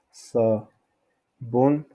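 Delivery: "sabon" slowly as sa... bon; long break inside "sabon"; syllabes séparées. Shown as a demonstration. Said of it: "on peut dire". (« sabon » lent, en deux syllabes)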